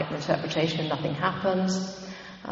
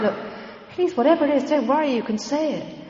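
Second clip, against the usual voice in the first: higher, earnest pleading to coax her; gentle urging; 7 semitones higher than usual. Retold or imitated. John begging the tearful woman to speak, high and pleading.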